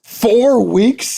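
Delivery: can't believe the number; astonished. Real, not acted. Host hears the four-week record.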